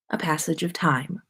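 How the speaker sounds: usual speaking voice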